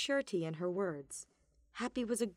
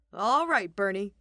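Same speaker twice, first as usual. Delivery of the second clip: loud call across the stage; 8 dB louder than usual. Acted. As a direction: call out to her across the room. Balthus calls across stage.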